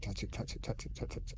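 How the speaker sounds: soft, breathy chant urging him; whispered egging on. Acted. Player urging a friend to touch the bodies, hushed chant. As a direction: tempt him in a hush.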